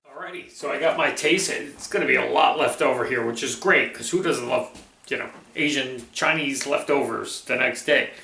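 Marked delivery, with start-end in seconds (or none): none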